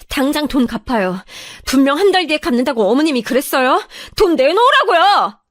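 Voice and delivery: indignant, escalating insistence; angry demand. Acted. Acted demand to repay the money.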